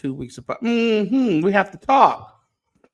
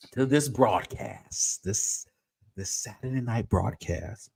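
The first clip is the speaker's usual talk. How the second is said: usual speaking voice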